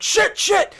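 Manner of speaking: loud high cry of pain; pained yell. Retold or imitated. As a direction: scream it as if hit. Soldier screaming in pain.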